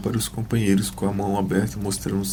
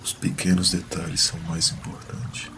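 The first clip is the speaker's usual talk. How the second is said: whispering; 6 dB quieter than usual. Acted. Recalled words murmured to himself.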